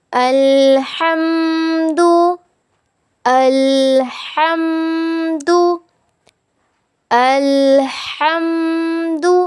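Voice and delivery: अल्हम्दु with a thin, light ह, recited slowly; thin haa. Shown as a demonstration. Teacher shows the light haa in अल्हम्दु. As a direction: keep the ह in अल्हम्दु fine, not heavy. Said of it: "हा बारीक पढ़ना है".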